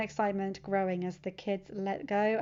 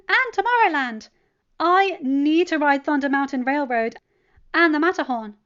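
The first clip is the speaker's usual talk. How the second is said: higher, much louder, eager; excited Wanda; 6 semitones higher, 11 dB louder than usual. A narrator's reading. Wanda adds rides to Peter, loud eager list. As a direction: rattle off the rides.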